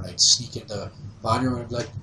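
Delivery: ordinary delivery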